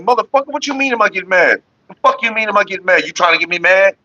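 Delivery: high-pitched, indignant rant in mock anger; mad younger self. Hot-headed younger self retorting to an innocent question, mock angry. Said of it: "that's, that's young me".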